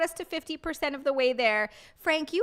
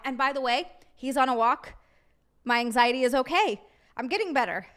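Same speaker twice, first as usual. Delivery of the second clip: ordinary talk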